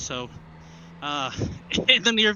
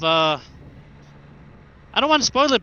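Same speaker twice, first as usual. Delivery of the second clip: ordinary delivery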